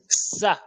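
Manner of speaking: "ça" with a crisp French S; French S sound. Shown as a demonstration. Teacher contrasting sin for learners, plain French S in "ça". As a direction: say "ça" with S, no lisp. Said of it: "comme le S A en français".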